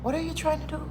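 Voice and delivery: puzzled. Retold or imitated. Store people failing to follow her English, puzzled. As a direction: imitate a baffled listener questioning you. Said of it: "and people be like".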